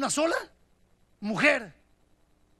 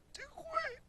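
put-on hushed child; 14 dB quieter than usual. Child says mother left.

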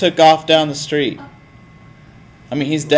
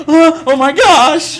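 high, slow gasping fake panic; mock alarm; 11 semitones higher, 3 dB louder, slower than usual. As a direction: pretend to be shocked. Feigning alarm to scare kid.

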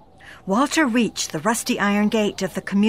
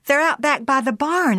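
startled wife; 6 semitones higher, 4 dB louder than usual. Acted. Wife answering, still taken aback.

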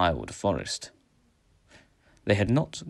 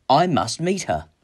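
raised, resolute voice; determined reply; 7 semitones higher, 5 dB louder than usual. A narrator's reading. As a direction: declare it firmly and loudly. Hero finishes his resolute answer.

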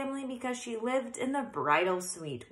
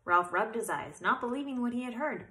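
ordinary delivery

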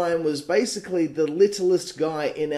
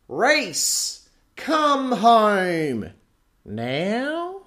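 mock version; 5 semitones higher, much slower than usual. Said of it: "different versions like".

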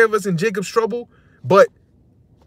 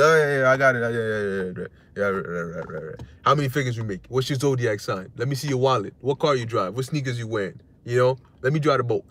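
mock interrogating woman; 8 semitones lower, 4 dB quieter than usual. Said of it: "you try to talk to".

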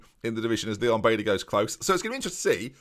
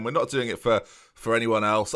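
ordinary talk, nothing marked

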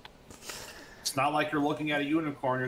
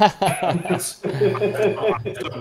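laughing, loud, drawn out; 7 semitones higher, 11 dB louder, much slower than usual. Real, not acted. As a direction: laugh through the words. Player laughing at the scene.

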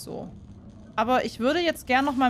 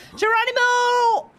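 jump cry; 12 semitones higher, 6 dB louder, slower than usual. Pretend. Diving into the sea.

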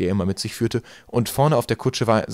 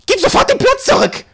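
very high, loud crone screech; shrieking; 10 dB louder than usual. A narrator's reading. Mad woman demanding Eddie return the seat, piercing shriek. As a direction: shriek the order at the top of the voice. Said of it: "kreischte sie".

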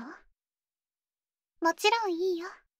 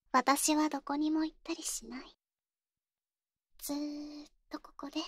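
possessive whisper. Acted. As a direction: promise it clingingly in a hush. 執着の誓いを囁く. (執着を込めた囁き)

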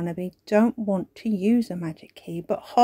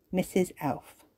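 plain reading voice

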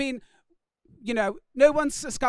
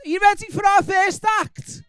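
crowd hype; 7 semitones higher, 5 dB louder than usual. Real, not acted. Hyping the crowd.

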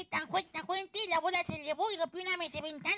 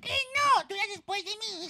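heated insistence; 3 semitones higher, 4 dB louder than usual. Acted. Cat quarrelling over who goes first, heated insistence.

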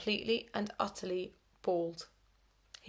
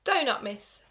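casual; 5 semitones higher, 5 dB louder than usual. A narrator's reading. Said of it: "said Gamble casually".

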